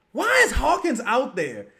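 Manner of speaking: 높고 크게 터뜨리는 분노; angry shout. Real, not acted. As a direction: yell the question at the screen in disbelief. Fan ranting at the coach's lineup.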